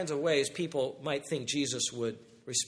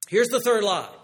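higher and louder announcement; announcing loudly; 5 semitones higher, 6 dB louder than usual. Shown as a demonstration. Preacher announcing the third lie to everyone, louder. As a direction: raise it like a warning bell. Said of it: "Buckle up for this one".

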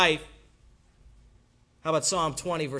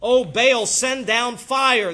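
slow, louder pleading parody; mocking prophets of Baal; 6 semitones higher, 7 dB louder, much slower than usual. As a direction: ham up the Baal prophets' plea. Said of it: "mocked the prophets of Baal".

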